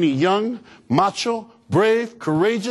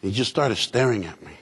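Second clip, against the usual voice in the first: usual speaking voice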